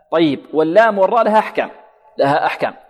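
ordinary talk, nothing marked

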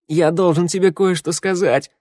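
high, whining plea. A narrator's reading. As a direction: whine it out, near tears. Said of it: "заныл Раду".

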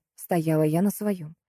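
ordinary delivery

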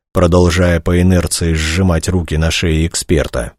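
ordinary delivery

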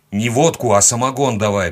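brisk order setting someone straight; firm correction. A narrator's reading. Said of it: "поправил я".

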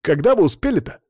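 high-pitched surprised question; amazed boy. A narrator's reading. Boy amazed at his teacher's arrival, surprised. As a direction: ask in astonishment, pitch jumping up. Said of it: "удивлялся Боря".

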